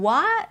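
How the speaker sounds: WHAT with an open ah, rising high; non-British wide what. Shown as a demonstration. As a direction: stretch WHAT upward on a wide ah. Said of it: "แบบนี้ก็จะไม่ใช่แนว British เนาะ". (what เสียงสระอา ลากขึ้นสูง)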